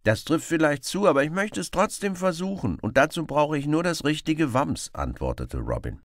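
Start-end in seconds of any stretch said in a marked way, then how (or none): 0.0-4.9 high, loud insistent reply. Acted. Robin insists to the beggar, high and determined. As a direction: insist stubbornly on trying.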